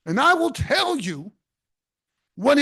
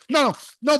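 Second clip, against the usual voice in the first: ordinary delivery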